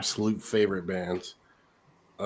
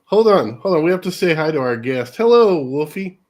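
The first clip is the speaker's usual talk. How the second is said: excited greeting; 7 semitones higher, 7 dB louder than usual. Real, not acted. Greeting a child guest.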